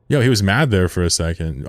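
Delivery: normal talking voice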